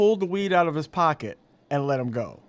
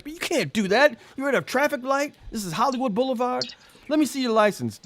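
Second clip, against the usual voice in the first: lightly voiced cop; 9 semitones higher than usual. Cop scolding Jay.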